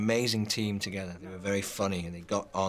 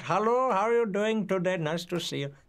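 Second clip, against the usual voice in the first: high, bright imitation of an Indian accent; playful Indian accent impression; 11 semitones higher than usual. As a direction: mimic a friend's strong Indian accent, pitched up. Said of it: "speaks with a very, uh, strong Indian accent".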